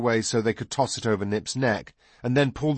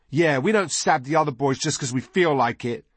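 put-on higher, emphatic boy's voice; indignant boy; 6 semitones higher, 3 dB louder than usual. A narrator's reading. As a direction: back up your brother indignantly. Narrator voices Nod backing brother.

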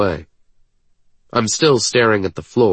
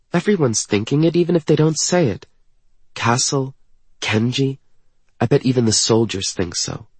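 higher, quick character voice; insecure young woman; 6 semitones higher, faster than usual. Acted. Woman saying everyone doubts her fitness, insecure. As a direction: list the doubters in a light, raised voice.

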